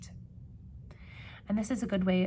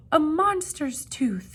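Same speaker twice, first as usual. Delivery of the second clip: high, loud, slow frightened yell; terrified yell; 8 semitones higher, 8 dB louder, slower than usual. Acted. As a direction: scream it out in fright. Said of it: "they yelled".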